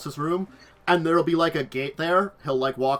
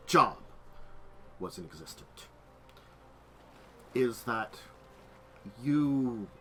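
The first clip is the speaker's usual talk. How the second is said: low, soft voice with weighty pauses; measured, lawyerly reasoning; formal, deliberate game character; 6 semitones lower, 8 dB quieter than usual. Acted. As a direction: lay out the argument piece by piece.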